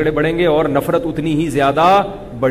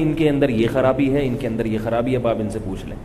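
softer, lower, restrained complaint; polite, respectful; 6 semitones lower, 5 dB quieter than usual. Retold or imitated. Wife courteously telling the mediator of husband's fault, polite and restrained. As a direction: say it gently, sinking quieter as you go. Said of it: "اس کی بیوی نے اتنی تمیز سے بات کی".

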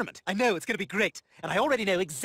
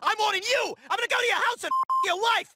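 furious shouting; 12 semitones higher than usual. Acted. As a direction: scream threats in a blind rage. Threatening the security guard.

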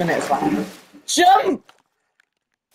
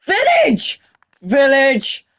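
high, thrilled shout; excited shout; 4 semitones higher, much slower than usual. Real, not acted. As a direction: call out the find in delight. Spots a village, excited.

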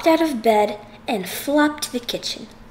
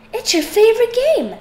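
excited; 11 semitones higher, 4 dB louder than usual. Acted. Froggy pitches golf plan.

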